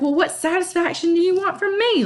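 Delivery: put-on high, exasperated girl; Juliet. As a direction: snap it up high, fed up. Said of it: "Juliet's like".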